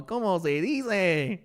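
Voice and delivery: slow, hammed-up mock Spanish accent; ridiculous Spanish accent. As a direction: drag it out in a silly Spanish accent. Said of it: "the most ridiculous accent ever".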